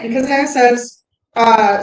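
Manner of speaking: ordinary talk, nothing marked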